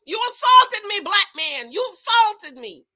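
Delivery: high, loud mock accusing shout; mock accusation. Vlogger imitating Lindsay accusing Olu, high shouted. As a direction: cry assault like she's been wronged. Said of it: "She's gonna cry assault".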